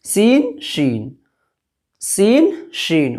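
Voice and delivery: sing-song letter contrast; contrasting सीन and शीन. Shown as a demonstration. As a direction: alternate सीन and शीन to show the difference. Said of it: "उसके बाद है सीन".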